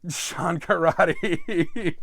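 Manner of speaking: real laugh at a slip; breaking into laughter. Real, not acted. Host fumbles Sean Connery's name, breaking into laughter. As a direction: crack up while dragging out the name.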